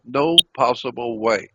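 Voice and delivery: ordinary talk, nothing marked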